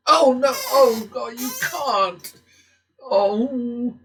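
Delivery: real dismay. Real, not acted. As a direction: wail at the bad card. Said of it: "I'm allowed to get emotional".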